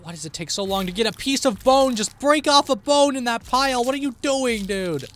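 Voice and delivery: loud, high impatient complaint, climbing then easing off; exasperated rant. Real, not acted. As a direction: berate a dawdling teammate. Impatient with slow game character.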